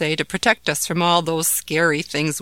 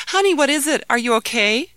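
worried mother; 9 semitones higher, 7 dB louder than usual. Acted. Mother rushes in alarmed.

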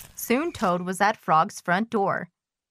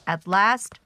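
usual speaking voice

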